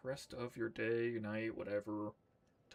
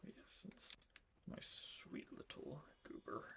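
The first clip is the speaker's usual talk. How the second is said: cooing; 3 semitones higher, 12 dB quieter, slower than usual. Real, not acted. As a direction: coo it gently. Cooing at pet.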